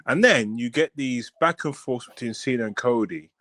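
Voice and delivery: normal talking voice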